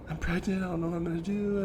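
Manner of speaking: high, rushed mock-crying voice; fake crying. Wife on the phone pranking him about a pregnancy, fake crying. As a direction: imitate someone faking tears, pitched well up. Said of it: "she fakes crying".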